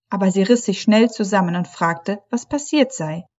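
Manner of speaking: plain reading voice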